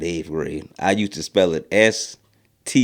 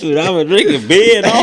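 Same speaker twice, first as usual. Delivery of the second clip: high, louder put-on buddy voice; mock Stinky excuse; 11 semitones higher, 6 dB louder than usual. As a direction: play the friend bragging about beer.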